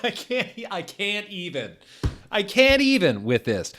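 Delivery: high helpless laughter; can't go on laughing; laughing disbelief. Real, not acted. Reader breaks up.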